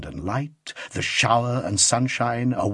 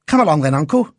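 put-on raised, louder boyish urging; lively call, higher and louder; boy's cry; 4 semitones higher, 5 dB louder, faster than usual. A narrator's reading. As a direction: call out eagerly to hurry an uncle along. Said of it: "cried the boy".

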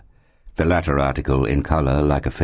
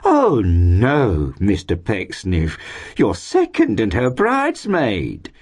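high, drawn-out flattering disbelief; landlady refusing to believe it; astonished exclamation; 6 semitones higher, slower than usual. A narrator's reading. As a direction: swoop through it in gushing amazement. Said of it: "exclaimed the lady".

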